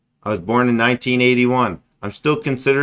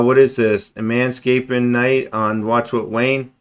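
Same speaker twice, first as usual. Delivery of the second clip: usual speaking voice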